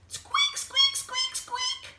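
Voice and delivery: tiny, very high-pitched squeaks; squeaking. Acted. Imitating the squeaking noise from inside the egg, squeaky. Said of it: "heard a squeaking noise".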